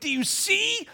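high, loud, slow divine insistence; emphatic voice of God. Retold or imitated. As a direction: drive the question home as God. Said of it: "And God went".

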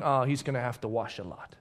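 low, soft mutter; hushed onlooker's comment; bystander's aside. Acted. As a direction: grumble it quietly to yourself. Bystander in courtyard remarking aside.

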